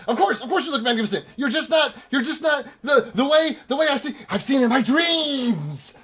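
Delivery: high, agitated rush slowing to a wail; stammer, then cry out like a visionary; frantic mad prophet; insane elf. Acted. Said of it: "fucking insane elf".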